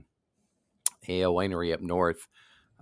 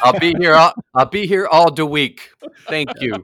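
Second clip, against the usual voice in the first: belted, high mock stage sign-off; milk it loudly like a hammy club act; lounge comedian; 8 semitones higher, 10 dB louder than usual. Co-host joining the closing-act joke, loud lounge comedian.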